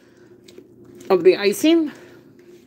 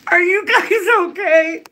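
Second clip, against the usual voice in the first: laughing, shaken; 10 semitones higher than usual. Real, not acted. After dropping the live phone, she laughs out to viewers. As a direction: check on viewers through real laughter.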